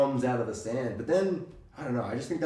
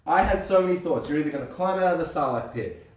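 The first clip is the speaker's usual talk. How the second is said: ordinary talk, nothing marked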